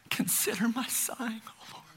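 hushed breathy whisper. Acted. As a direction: breathe the verse like a sigh. Reading a sighing psalm.

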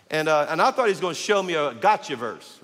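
ordinary talk

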